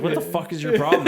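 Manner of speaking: high, loud, laughing wife's outburst; exasperated outburst. Retold or imitated. Wife turning on her husband, loud and laughing. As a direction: snap at him half laughing. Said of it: "She was like".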